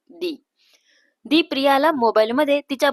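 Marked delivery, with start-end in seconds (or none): none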